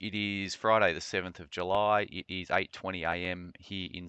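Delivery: brisk, upbeat show opener; podcast intro read. Acted. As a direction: announce the show with energy.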